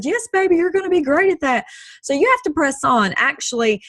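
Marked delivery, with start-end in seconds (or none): 0.0-1.7 higher-pitched doting parent; encouraging parent. Retold or imitated. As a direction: mimic a parent cheering on their child. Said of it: "probably your mom and your dad or whoever that says".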